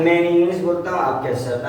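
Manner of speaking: usual speaking voice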